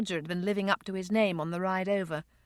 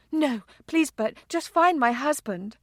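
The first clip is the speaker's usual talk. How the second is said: higher, urgent begging; pleading, pained and pitched up; desperate plea; 5 semitones higher, 3 dB louder than usual. Acted. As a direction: insist through rising distress. Distressed wife begs driver again.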